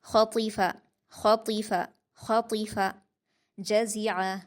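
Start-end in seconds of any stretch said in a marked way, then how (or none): 0.1-2.9 ख़तीफ़ा with heavy ख़ and त, light फ़; full-mouthed ख़ and त in ख़तीफ़ा; ख़तीफ़ा, quick, heavy first two letters; heavy kha and ta. Shown as a demonstration. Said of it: "आपको दोनों को भारी पढ़ना है".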